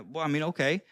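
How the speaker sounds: ordinary talk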